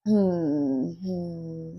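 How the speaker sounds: ordinary delivery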